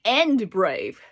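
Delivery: slow, loud, hammed-up admiration; mock impressed. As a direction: gush over them, playing amazed. Teacher acts impressed.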